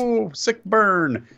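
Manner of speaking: high, drawn-out mock "ooh"; mock-impressed reaction. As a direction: stretch it out like a crowd reacting to a burn. Mock crowd reaction to an insult.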